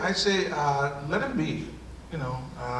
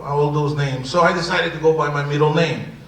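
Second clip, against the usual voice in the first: ordinary talk, nothing marked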